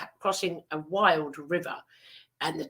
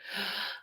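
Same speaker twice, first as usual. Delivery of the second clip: quiet gasp of realisation; sharp gasp; 10 dB quieter than usual. Retold or imitated. Boy realising he is the tenth, soft gasp. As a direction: gasp as it dawns.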